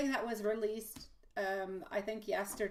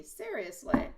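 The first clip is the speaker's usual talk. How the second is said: ordinary talk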